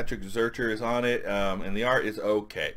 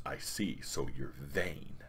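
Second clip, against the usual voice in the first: low, slow, soft barbarian; hushed mock-grave verdict; Conan's voice; 6 semitones lower, 9 dB quieter, slower than usual. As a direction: draw it out like a solemn warrior. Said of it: "This is how they're talking".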